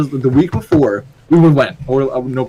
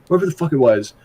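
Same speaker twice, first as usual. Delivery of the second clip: ordinary talk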